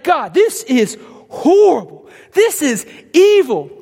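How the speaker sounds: slow, high, loud protest; angry cry to God. Retold or imitated. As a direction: drag it out in anguished anger.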